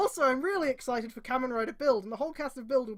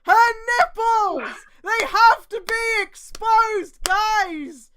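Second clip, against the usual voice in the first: high, loud, slow exasperated rant; mock outrage; 10 semitones higher, 13 dB louder, much slower than usual. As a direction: rant at the absurd writing.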